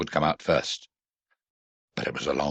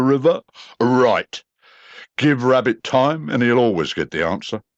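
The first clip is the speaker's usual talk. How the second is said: Eeyore's voice; 7 semitones higher, 4 dB louder than usual. A narrator's reading. Eeyore answers Rabbit.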